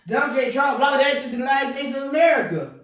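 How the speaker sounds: ordinary delivery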